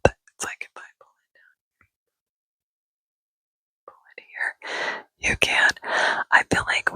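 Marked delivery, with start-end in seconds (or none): 0.1-4.9 mic pulled away. Shown as a demonstration. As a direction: whisper fading off the mic. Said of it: "unless I bring the mic back up".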